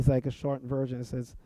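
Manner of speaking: usual speaking voice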